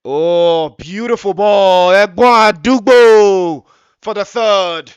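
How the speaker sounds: huge, high drawn-out goal shout; goal yell. Real, not acted. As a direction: scream the goal out, long and slow. Third goal called live.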